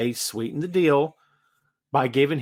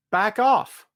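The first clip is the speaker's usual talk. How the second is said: louder mock warning; loud back off; 7 semitones higher, 5 dB louder than usual. Shown as a demonstration. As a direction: Back off shouted, off rising. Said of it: "Two words".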